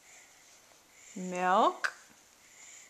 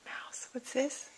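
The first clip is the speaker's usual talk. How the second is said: mouse whisper; 8 dB quieter, slower than usual. Acted. Naming the mouse block.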